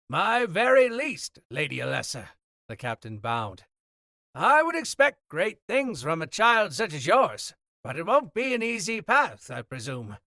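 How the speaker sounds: loud, high, booming captain over the noise; hearty sea captain. Acted. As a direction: project like a jovial captain on the docks. Captain bows to Alessa.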